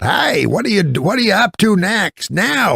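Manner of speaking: put-on high-pitched, hollering old-timer; loud old man. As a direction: holler it like a hard-of-hearing old fella. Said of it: "so he talks super loud".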